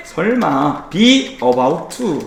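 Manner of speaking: ordinary delivery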